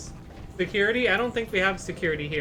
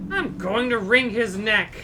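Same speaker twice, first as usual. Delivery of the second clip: mock anger; 4 semitones higher, 6 dB louder, slower than usual. Angry threat about janitor.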